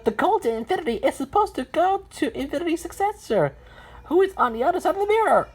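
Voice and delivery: high-pitched, hurried explanation; explaining number character. Acted. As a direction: explain the quest in a high cartoon voice.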